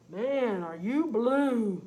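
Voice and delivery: loud friendly dog; farm dog voice. Acted. Farm dog Rover greeting blue Tom, loud hearty voice. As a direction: bark a big hello like a farm dog.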